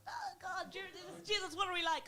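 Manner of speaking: mock wailing lament. Comedian acting out self-pitying Irish reaction, mock wailing.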